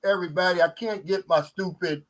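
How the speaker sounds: ordinary delivery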